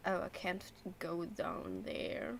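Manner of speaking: normal talking voice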